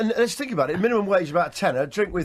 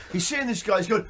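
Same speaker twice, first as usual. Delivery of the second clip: ordinary delivery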